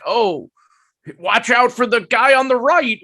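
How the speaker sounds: high-pitched mock announcer call. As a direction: imitate an overeager announcer calling the play. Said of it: "you could hear the P A guy be like".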